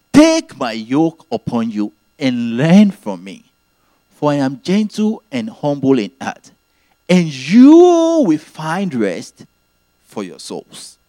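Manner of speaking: slow, weighty reading of the verse, stretching find rest; scripture quotation. Acted. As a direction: lay the verse out slowly and solemnly. Preacher quoting Jesus' words.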